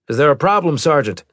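stern captain voice. Acted. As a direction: voice the captain with authority. Maddox voiced in story.

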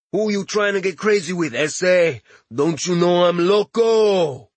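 put-on thick Latin accent, swaggering. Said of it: "In a thick Latin accent".

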